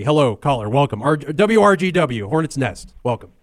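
normal talking voice

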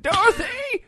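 very high, loud calling out Dorothy; hammed-up old man hollering; mock old man call.

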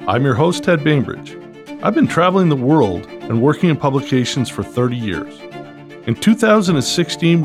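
upbeat announcer read over music; bright, punchy show-opening voice; podcast host intro. Acted.

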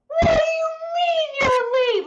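very high, full-out incredulous shriek; screaming in outrage. Retold or imitated. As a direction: scream it at the guy who hit you. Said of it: "I'm screaming at this guy".

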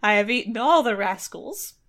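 loud triumphant gloat; gloating dodo. Retold or imitated. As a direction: crow loudly over his victory. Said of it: "he's like".

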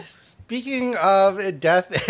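usual speaking voice

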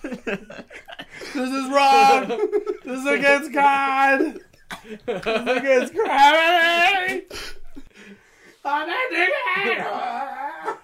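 high, slow, laughing panic; panicked pilot voice. Pretend. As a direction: wail like a bewildered pilot floating off. Acting pilot swept up.

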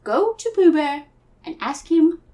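put-on high British boy turning cockney; cockney Christopher Robin. Christopher Robin calms Piglet in a drifting cockney accent. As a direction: reassure in a hammed-up cockney accent. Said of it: "I'm getting more and more cockney".

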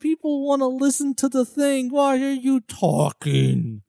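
high, slow, incredulous scolding; exasperated high voice. Retold or imitated. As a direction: play an annoyed listener. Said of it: "That's just like".

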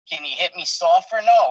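usual speaking voice

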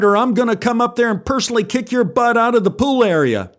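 high, threatening lifeguard shout; stern shouted threat. Acted. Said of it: "shouted the lifeguard".

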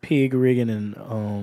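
slow, low Georgia country drawl; laid-back Georgia country talk, unhurried; Georgia accent. Shown as a demonstration. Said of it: "this is my Georgia accent".

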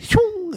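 high whooshing bullet sound; quick high-pitched zip of a bullet; bullet whizzing past. Retold or imitated. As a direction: imitate a bullet flying by. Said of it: "That bullet said".

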